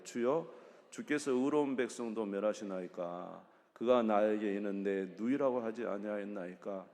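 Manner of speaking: loud, steady group reading of the verse; scripture read aloud. Real, not acted. Leading the congregation in a loud scripture reading. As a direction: read it out together, firm and even.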